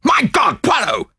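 high, loud exclamation; shocked cry. A narrator's reading. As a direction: cry out in horror to a companion. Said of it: "I cried".

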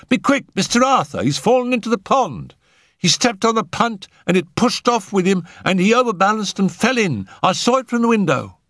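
very high, breathless-with-news panic; agitated housemaid. A narrator's reading. Housemaid reporting a man fallen into the pond, agitated. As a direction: blurt the alarm in a high voice. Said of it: "an agitated housemaid rushed".

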